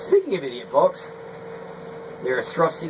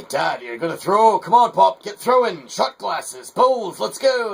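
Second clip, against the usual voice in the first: higher, louder eager urging; son egging him on; 5 semitones higher, 4 dB louder than usual. Retold or imitated. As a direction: push him like an impatient son. Son urging dad to throw.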